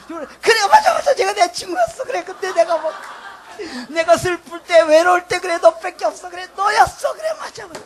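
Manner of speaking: high, loud overwhelmed outburst; imitated moved audience. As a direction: act out listeners crying out in heartfelt agreement. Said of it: "사람들이 다 울었어요". (감격해서 울부짖듯 맞장구치는 흉내)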